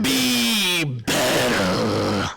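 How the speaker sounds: very slow drawn-out wrestler growl; wrestling voice. Acted. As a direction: stretch it out like a ring promo. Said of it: "That was my wrestling voice".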